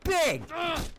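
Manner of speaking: high excited shout; excited yelp. Real, not acted. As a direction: burst out high and loud. Excited loot find.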